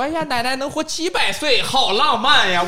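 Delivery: laughing, slow, sugary pretend comfort; teasing singsong of a comforting fib; mock sweet lie. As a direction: play the grandma who would lie nicely. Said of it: "从来不会骗你说".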